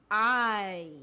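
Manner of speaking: "I." said loud as the long vowel of kite; long I sound. Shown as a demonstration. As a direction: stretch "I." like the letter name. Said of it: "Did you hear that?".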